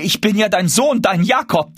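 high, scornful mimicry without a pause; indignant quoting. A narrator's reading. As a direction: throw his plea back in outrage. Mother indignantly quotes his plea back. (empört nachgeäfft, mit hoher Stimme)

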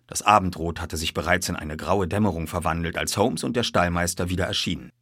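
plain reading voice

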